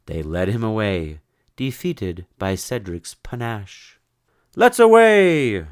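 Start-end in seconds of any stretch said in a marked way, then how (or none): 4.6-5.7 high, loud, slow proclamation; triumphant. Acted. Sir Cedric proclaiming departure to his party, triumphant. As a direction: sing it out in victory. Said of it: "said Sir Cedric triumphant".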